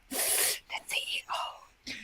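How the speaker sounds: slow, very soft stage whisper; hushed whisper. Retold or imitated. Coordinator explaining her worry to the facilitator, slow hushed whisper. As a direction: whisper it slowly, like a secret. Coordinator warns about the CEO.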